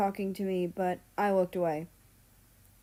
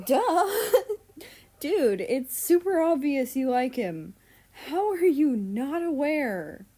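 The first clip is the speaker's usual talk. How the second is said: high, loud, laughing disbelief; laughing exasperated; 7 semitones higher, 6 dB louder than usual. Acted. Mal laughs at Russia's cluelessness, loud and high. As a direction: cackle it at her, then scold.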